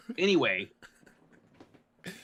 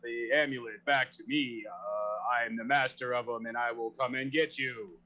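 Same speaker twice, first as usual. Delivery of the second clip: normal talking voice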